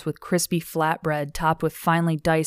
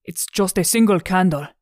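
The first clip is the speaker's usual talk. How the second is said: louder, sheepish character voice; stammering Joseph; 3 semitones higher, 5 dB louder than usual. Acted. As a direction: protest like someone caught out. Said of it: "Joseph stammered".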